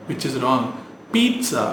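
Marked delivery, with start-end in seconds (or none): 1.1-1.6 Pizza as PEET-sa, high first syllable; correct pronunciation. Shown as a demonstration. Teacher modelling the s sound, correct pronunciation. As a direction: say Pizza with a crisp ts. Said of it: "You pronounce it as pizza".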